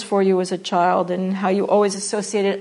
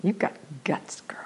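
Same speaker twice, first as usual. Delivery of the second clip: breathy, much softer whisper to Leah; seductive whisper; 6 semitones lower, 9 dB quieter than usual. Shown as a demonstration. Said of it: "If I'm seducing her".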